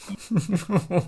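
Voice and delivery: sudden high burst of laughter. Real, not acted. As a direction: laugh out loud.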